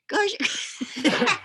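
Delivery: high squeaky call breaking into laughter; high-pitched pet coaxing. Retold or imitated. Child undeterred by a stamping skunk, high coaxing. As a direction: coo it high, on the edge of laughing.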